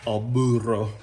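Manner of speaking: "burro" with a rolled, trilled R. Shown as a demonstration. Home cook practicing the Italian name, "burro" with a rolled R. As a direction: roll the R in "burro" hard, Italian style. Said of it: "I'm working my R's".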